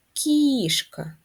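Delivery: Кішка with a long, high first vowel; Кішка with exaggerated first-syllable stress. Shown as a demonstration. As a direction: stretch and lift the "Кі" of Кішка. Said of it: "Наголос на перший склад".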